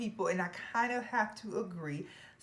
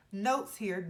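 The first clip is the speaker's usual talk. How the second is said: ordinary talk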